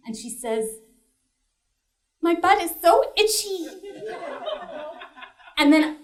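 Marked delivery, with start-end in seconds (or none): 2.2-3.7 higher-pitched, lightly imitated tearful child; a hint of a whiny sob; mock crying. Daughter complaining to her mother at night, mock crying. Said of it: "she just starts crying".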